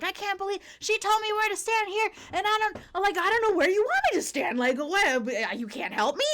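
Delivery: high, breathless-style customer rant; imitated freak-out. Customer freaking out at staff who won't help, imitated rant. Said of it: "freaking out even more".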